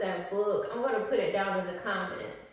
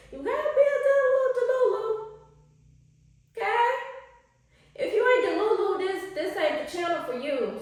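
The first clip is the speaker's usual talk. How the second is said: squealy high hype; 11 semitones higher than usual. Real, not acted. Vlogger squealing at her viewers to be delulu.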